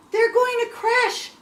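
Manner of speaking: high, loud cry of alarm; gasped alarm. A narrator's reading. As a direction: blurt it out high in panic. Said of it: "gasped Stella Luna".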